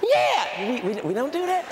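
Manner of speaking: high mocking gentle voice with a laugh. Preacher mocking a lady gently scolding her kid. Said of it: "Sitting up there talk".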